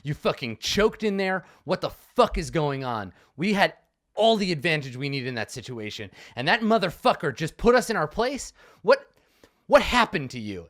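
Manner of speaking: angry shouting. Acted. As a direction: get in his face and shout. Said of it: "I'm just, like, shouting at you".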